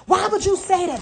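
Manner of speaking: high, loud indignant snap; a guilty person lashing back, caught out; defensive outburst. Retold or imitated. Relative caught mocking snaps back in indignation. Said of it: "Now you're gonna see the demons come out".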